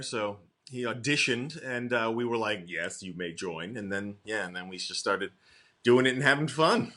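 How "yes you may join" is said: low, slow, softly granted permission; mock-solemn approval. Group admitting a newcomer after his audition, mock-solemn. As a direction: lightly play the lofty judge, low and slow.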